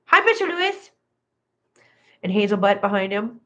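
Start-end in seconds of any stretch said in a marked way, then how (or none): 0.1-0.9 very high-pitched pet greeting; pet talk. Real, not acted. Owner saying hi to a pet, very high pet-talk voice. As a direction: squeal a little hello to the animal.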